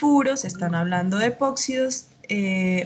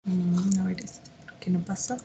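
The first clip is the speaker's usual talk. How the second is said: soft muttering to herself, under her breath; muttered aside; 2 semitones lower, 6 dB quieter than usual. Real, not acted. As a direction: mumble it quietly while fiddling with the slides. Muttering to herself over slides. (murmurando para sí, en voz baja)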